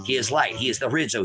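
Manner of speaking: ordinary delivery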